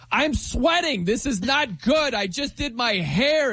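high, slow imitation of a fussy girl at the gym; mock whiny yell. Host imitating guest complaining to her coach, high slow whine. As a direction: whine it like a diva worried about her hair. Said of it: "you're yelling at your coach like".

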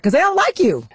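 blurted out high and loud; high punchline. Real, not acted. Punchline of countdown.

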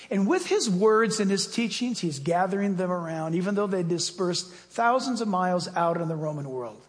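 usual speaking voice

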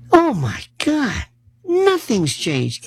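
hammed-up sob of disbelief; a comic near-cry over nothing changing; mock despair. Mock despair at defense spending.

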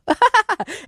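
quick high amused laugh; short laugh. Real, not acted. Laughs at own acronym.

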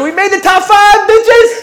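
loud, high-pitched triumphant shout; gloating cheer. Acted. Pfizer staff celebrating their ranking, gloating shout. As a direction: whoop it out like you just won.

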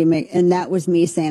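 ordinary delivery

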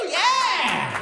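high, drawn-out celebratory yell; mock cheer. Celebrating moving in with his girlfriend, high mock-excited cheer. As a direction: whoop it up, pitch soaring.

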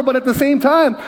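ordinary talk, nothing marked

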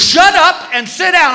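high, frantic mock order; freaking out. Flight attendant freaks out at a passenger, high panicked command. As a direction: order everyone in a hammed-up panic. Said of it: "until the flight attendant freaks out".

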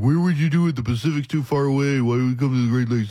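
lightly mocking run-on complaint; hint of a mocking naysayer; mimicking objectors. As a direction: imitate naysayers piling up objections.

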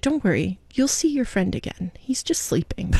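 soft imitation of a comforting child; gently reassure, fading almost to a whisper; hushed child consoling. Daughter consoles mommy about her friend, hushed imitated reassurance.

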